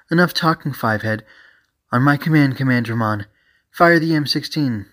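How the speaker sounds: commanding. Acted. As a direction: give the order to open fire. Officer orders her partner to fire.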